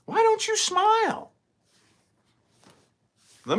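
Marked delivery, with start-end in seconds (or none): 0.0-1.3 much higher sing-song mock voice; mocking stranger. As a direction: mimic a stranger in line, high and patronizing. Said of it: "somebody will say".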